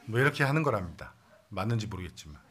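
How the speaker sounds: ordinary delivery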